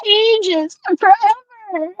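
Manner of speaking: a hurt cry to a friend; real tears, voice wavering; tearful. Real, not acted. Tearful plea to former friend.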